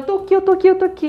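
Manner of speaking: high, repeated relieved cry; imitated rescued girl, high-pitched; emotional reassurance. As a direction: reassure them you really made it back.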